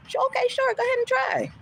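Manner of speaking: high, sarcastic "sure, okay" then an incredulous "the hell?"; mocking a hotel's hollow offer, high and sarcastic; sarcastic mock agreement. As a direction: play along mockingly, then scoff.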